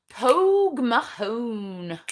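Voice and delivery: Pogue Mahone drawn out slowly, each syllable separate; slow careful try at Pogue Mahone. Shown as a demonstration. Viewer's name read out, slowly sounded out. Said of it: "Is that even close?".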